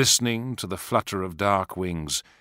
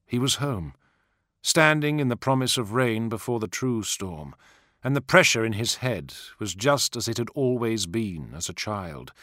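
usual speaking voice

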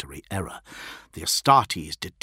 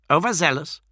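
higher, curt incredulous bark; barked question; 8 semitones higher, 4 dB louder than usual. A narrator's reading. Fulgrim challenges the envoy's euphemism, barking. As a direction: throw the word back angrily. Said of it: "barked Fulgrim".

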